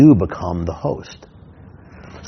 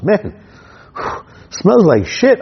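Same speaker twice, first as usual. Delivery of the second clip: weary disgusted subject; 7 semitones higher, slower than usual. Retold or imitated. Subject complaining, sighing.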